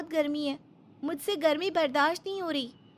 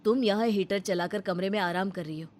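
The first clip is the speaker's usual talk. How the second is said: lower, reproachful husband; lower, scolding his wife for leaving his mother to work; husband's voice; 8 semitones lower than usual. Acted. Husband scolding his wife over his sick mother, lower.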